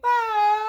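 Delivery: loud high scream. Retold or imitated. SpongeBob running for the door, screaming. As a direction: let out a panicked scream. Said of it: "he's screaming".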